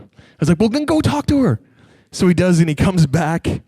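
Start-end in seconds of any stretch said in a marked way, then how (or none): 0.6-1.6 high-pitched, impatient retold retort; comic high-voiced exasperation; exasperated. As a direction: send them off in a pitched-up voice. Acts out exasperated reply.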